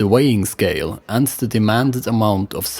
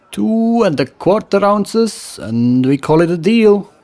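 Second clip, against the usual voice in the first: higher, louder West Frankish trader voice; West Frankish accent; 10 semitones higher, 3 dB louder than usual. Acted. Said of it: "the trader countered".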